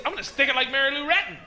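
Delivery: ordinary delivery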